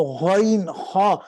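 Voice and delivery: غائن خا slow, thick and throaty; thick Arabic ghain demo; heavy ghain kha. Shown as a demonstration. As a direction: make غائن خا full and heavy. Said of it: "یہ دونوں موٹے آئیں گے".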